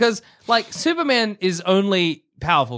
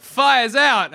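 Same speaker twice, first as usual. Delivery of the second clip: triumphant shout; 6 semitones higher, 6 dB louder than usual. Pretend. Hero catchphrase.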